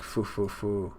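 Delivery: soft, low, huffing breaths; heavy breathing. Retold or imitated. Big Mom huffing mid-tantrum, soft panting breaths. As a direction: puff out each foo like a panting monster.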